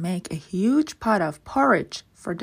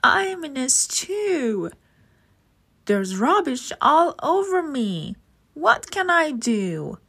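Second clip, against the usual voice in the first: Nessie's distressed voice; 7 semitones higher than usual. A narrator's reading. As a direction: wail from the basket. Nessie stuck in the rubbish.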